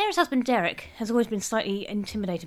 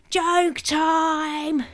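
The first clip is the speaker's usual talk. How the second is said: put-on loud, high child's announcement; announcing; 9 semitones higher, 6 dB louder than usual. A narrator's reading. William calling everyone to hear his joke, announcing. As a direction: proclaim it to silence the whole table. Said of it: "announced William".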